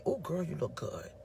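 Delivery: soft, low admiring voice; a husband gently charmed by the dress; warm compliment. Retold or imitated. Husband softly praising his wife's dress. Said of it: "He could say".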